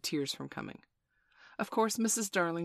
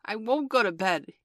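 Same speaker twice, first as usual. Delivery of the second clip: put-on defiant child's raised shout; higher, louder, stubborn little boy; 6 semitones higher, 7 dB louder than usual. A narrator's reading. Small boy refusing bedtime to his nurse, shouted. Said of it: "he had shouted".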